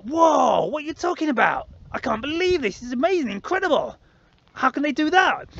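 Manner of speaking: high, wide-eyed astonishment; amazed. Retold or imitated. Imagined friends hearing the news, high and amazed. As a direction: play the dazzled listener he hoped for. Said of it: "I expected them to be like".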